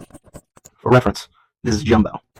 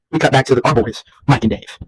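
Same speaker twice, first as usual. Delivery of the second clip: ordinary talk, nothing marked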